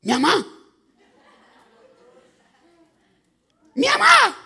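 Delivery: high, very loud cry of recognition; excited shout. Retold or imitated. Boy telling playmates he heard his mother, shouting. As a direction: burst out yelling for your mother.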